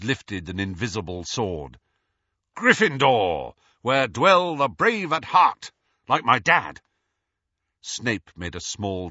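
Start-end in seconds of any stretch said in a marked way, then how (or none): 2.6-6.8 put-on loud, high declaiming boy; proud, ringing boast; mock-heroic. A narrator's reading. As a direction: proclaim it like a knight raising a sword. James declaims with invisible sword raised.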